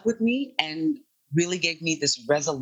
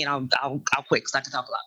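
normal talking voice

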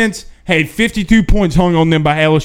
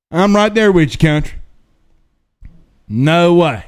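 normal talking voice

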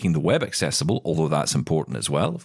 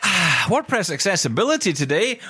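high-pitched mock enthusiasm after a sigh; sigh, then announce it with hollow cheer; dismissive; 12 semitones higher than usual. Real, not acted. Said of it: "that sounded a little bit dismissive".

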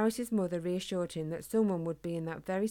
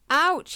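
sharp loud yelp of pain, much higher; cry of pain; 10 semitones higher, 11 dB louder than usual. A narrator's reading. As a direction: yelp as you hit the ground. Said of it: "he cried".

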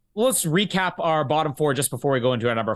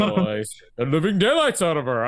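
a light, smooth hint of a suave English actor; Roger Moore impression. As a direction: toss the line off lightly, a gentle nod to a classic Bond actor. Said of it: "imagining how good it would have been with Roger Moore reading that line".

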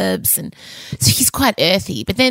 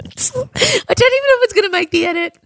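laughing. Real, not acted. Laughing after slip.

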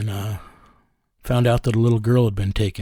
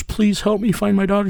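raised-pitch imitation of a sobbing, pleading mother; begging mother; 10 semitones higher than usual. Mother begging the detective on the phone, high pleading voice. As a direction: play the desperate mother begging on her knees. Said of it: "and she was begging me".

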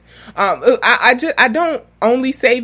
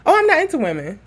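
higher, hasty mock denial; quick pitched-up retold brush-off; really quick; 8 semitones higher, faster than usual. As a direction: imitate her instant reply. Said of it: "Like, really quick, y'all".

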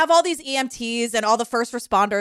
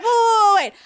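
higher and louder, a hasty whoa whoa whoa; hammed-up lawyer jumping in to stop things; alarmed lawyer; 6 semitones higher, 5 dB louder than usual. Lawyer stopping the courtroom, mock alarmed.

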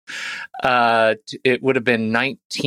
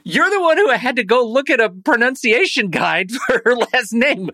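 mock indignation; 11 semitones higher than usual. Real, not acted. Playful blame between podcast cohosts.

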